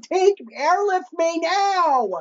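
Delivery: mock pleading contestant. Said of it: "I would be like".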